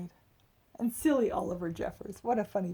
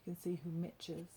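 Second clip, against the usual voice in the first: plain reading voice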